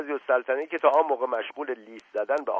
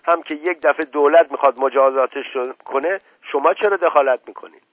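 loud, exasperated outburst; angry shouting; 7 dB louder than usual. Acted. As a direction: shout it out in indignant anger. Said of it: "عصبانی فریاد زد". (فریاد عصبانی)

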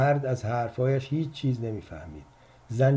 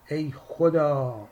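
slow drawn-out wail to God; cry out; 4 semitones higher, 3 dB louder, much slower than usual. A narrator's reading. Wife cries out after slamming the phone, drawn out. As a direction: cry out in despair. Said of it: "داد زد".

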